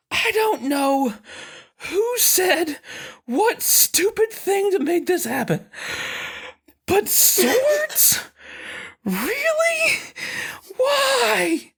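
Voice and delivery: put-on high, strained whining kid voice; a breathless little boy pleading; high-pitched frustrated child; exasperated child. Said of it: "He goes".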